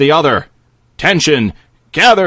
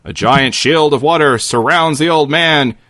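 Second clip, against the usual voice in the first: ordinary talk, nothing marked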